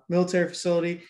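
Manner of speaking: ordinary talk, nothing marked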